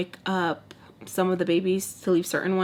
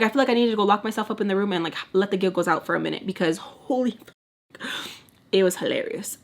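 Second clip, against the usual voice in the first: ordinary talk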